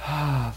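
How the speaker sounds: low, soft mock sigh; acted deflated "ah"; sinking-heart sigh. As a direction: sigh it out as if disheartened. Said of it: "Usually our heart goes".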